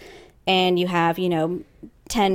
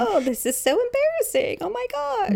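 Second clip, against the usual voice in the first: squeaky mock embarrassment.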